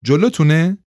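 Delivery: high-pitched called question; understated yell down the line; mild shout. A narrator's reading. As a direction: call it out high, without much volume. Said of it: "زن خبرنگار فریاد کشید".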